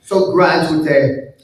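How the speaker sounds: usual speaking voice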